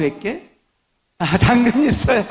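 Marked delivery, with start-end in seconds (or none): 1.2-2.2 much higher, louder cheeky rabbit voice; spring the punchline in a bright high rabbit voice; triumphant punchline. Acted. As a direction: rabbit smugly asking for carrots after all.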